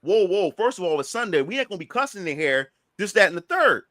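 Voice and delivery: ordinary delivery, nothing marked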